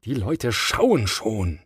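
ordinary delivery, nothing marked

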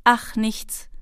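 usual speaking voice

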